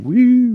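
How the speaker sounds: high-pitched whoop; short high cheering "woo"; celebratory woo. Real, not acted. Cohost welcoming a friend to the father club, high whoop.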